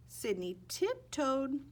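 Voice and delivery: ordinary delivery, nothing marked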